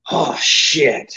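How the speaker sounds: drawn-out imitation of a boss's signature curse; Eddie Graham's catchphrase. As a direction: stretch both words out, mimicking him. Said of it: "that was his famous thing".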